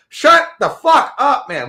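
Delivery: high, loud, slow "shut the fuck up"; angry rant. Retold or imitated. Ranting at an imagined loud talker, slow loud snarl. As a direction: drag it out in disgust. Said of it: "ranting at imagined talker".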